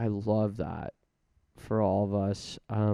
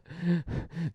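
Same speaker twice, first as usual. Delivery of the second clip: short laugh; 7 semitones higher than usual. Real, not acted. Laughs at own joke.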